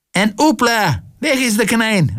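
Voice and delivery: high-pitched magician's flourish; high, triumphant trick announcement; stage cry. A narrator's reading. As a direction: call out the vanishing high, like a showman. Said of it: "ruibe, en lichtte de hout".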